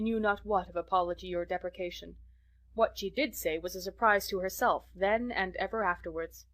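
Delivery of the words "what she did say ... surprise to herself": usual speaking voice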